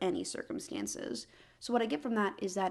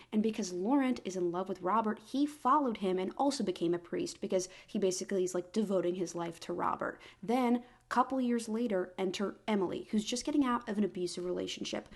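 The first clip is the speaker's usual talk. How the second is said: ordinary talk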